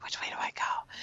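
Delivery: voiceless retold whisper; whispered question. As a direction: wonder it under your breath, imitating her. Retelling Arya wondering where to go.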